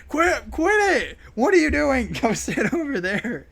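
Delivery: high, loud mock teacher telling off; hammed-up stern lunch teacher; scolding teacher. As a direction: order him away, half laughing. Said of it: "was like".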